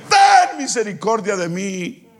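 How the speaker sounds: high drawn-out cry for mercy. Retold or imitated. Preacher acting the Canaanite woman's plea, crying out. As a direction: wail the first word long, then come down. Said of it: "gritaba esa mujer".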